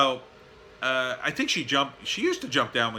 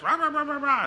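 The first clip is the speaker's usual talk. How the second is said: mock barking. Imitating dogs barking.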